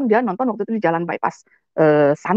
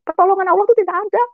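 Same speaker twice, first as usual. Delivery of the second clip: angry crying outcry; 9 semitones higher, faster than usual. Retold or imitated. Retelling her tearful outburst at God, a high angry wail. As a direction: cry out at God in tearful anger. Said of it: "Saya nangis, saya marah".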